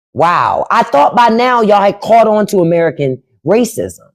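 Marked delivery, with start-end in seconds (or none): none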